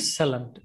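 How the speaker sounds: Excellent ending in a low "lunt"; reduced last syllable. Shown as a demonstration. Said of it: "It's lunt, not lent".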